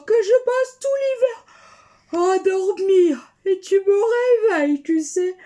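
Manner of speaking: marmotte grognonne, voix plus haute et plus forte; marmotte réveillée. Acted. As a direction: une marmotte tirée de son sommeil qui râle. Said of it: "dit la marmotte". (marmotte réveillée qui proteste fort)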